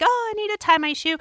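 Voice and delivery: high, quick, fake-casual cover story; mock innocent. Covering for sneakily snipping succulents, mock innocent.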